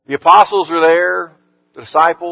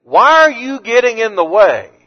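raised, louder indignant rebuke, as Jesus; 6 semitones higher, 5 dB louder than usual. Retold or imitated. Jesus rebuking the disciples who block the children, indignant and raised. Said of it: "they're the ones that he's indignant at".

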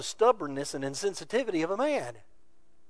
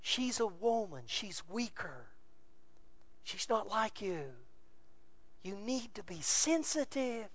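much softer, slow and gentle; tender plea; 4 semitones higher, 12 dB quieter than usual. Real, not acted. Pleading with husbands, soft tender voice. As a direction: lean in and plead softly.

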